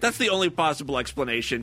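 normal talking voice

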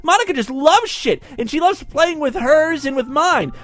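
put-on giddy girl voice.

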